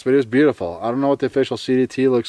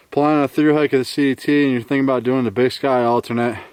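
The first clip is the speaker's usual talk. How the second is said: usual speaking voice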